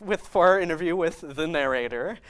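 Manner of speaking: usual speaking voice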